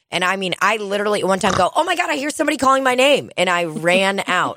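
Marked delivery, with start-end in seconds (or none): 1.7-3.3 mock alarm. Fake excuse to escape bar.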